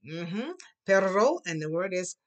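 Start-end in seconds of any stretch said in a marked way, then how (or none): 0.9-1.4 loud Perro with a trilled rr; rolling rr in Perro, called out; Perro with a strong rr for the class; rolled r. Shown as a demonstration. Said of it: "your tongue must roll".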